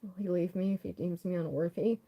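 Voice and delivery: normal talking voice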